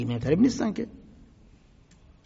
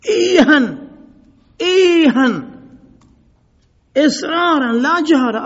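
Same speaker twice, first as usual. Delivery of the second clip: angry caliph bellowing, much louder and higher; shout; 12 semitones higher, 14 dB louder, slower than usual. Acted. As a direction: roar it from the pulpit at the crowd. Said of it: "داد زد". (فریاد خشمگین و بلند خلیفه)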